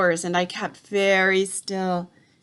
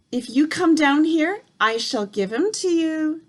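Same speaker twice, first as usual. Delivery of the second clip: luring witch; 6 semitones higher, 4 dB louder than usual. A narrator's reading. Witch luring boy from tree.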